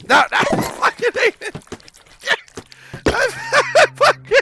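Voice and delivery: high, loud squeals through laughter; hysterical laughing protest. Real, not acted. Player hating the silly game, shrieking with laughter. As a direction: protest in a shriek while cracking up. Losing it laughing while playing.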